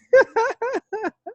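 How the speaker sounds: a high, delighted laugh. Real, not acted. Laughing at the miracle story.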